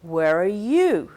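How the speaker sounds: YOU loud and slow with a fall; "you" stressed, falling at the end. Shown as a demonstration. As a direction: lean on "you" in "Where are you?". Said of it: "the third one".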